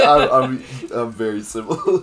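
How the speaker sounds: laughing. Real, not acted. Laughing at shared habit.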